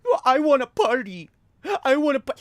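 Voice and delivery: high, loud mocking whine of a selfish relative; whiny relative. Mocking a family member who defends gender reveals, high whiny voice. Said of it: "defend them with, like, completely, uh, selfish reasoning".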